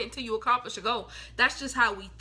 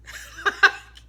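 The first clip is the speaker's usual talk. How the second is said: breathy burst of laughter; 6 dB louder than usual. Real, not acted. Laughs after mock quote.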